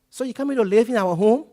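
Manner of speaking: loud, startled, high; alarmed question. Retold or imitated. Philadelphia contact asks in alarm if he's moving in. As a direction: blurt it out in alarm.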